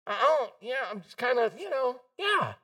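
mock sheepish hedging; sheepish admission. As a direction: admit it reluctantly, in halting bits. Imagined scared believers answer reluctantly.

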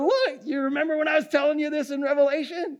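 high, excited aside to a friend; excited call-out. Pretend. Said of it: "I'm gonna be going".

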